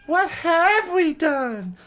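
plain reading voice

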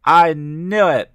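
loud, high, drawn-out cry; slow, stretched "knew", shouted high. A narrator's reading. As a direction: cry out in triumphant accusation. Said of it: "Brenda cried".

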